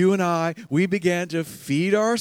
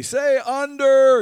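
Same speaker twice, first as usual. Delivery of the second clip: loud, high, drawn-out prompt to the crowd; raised, stretched call-and-response cue; calling out to the room; 9 semitones higher, 6 dB louder, slower than usual. Retold or imitated. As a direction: call it out for everyone to repeat. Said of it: "I'd say".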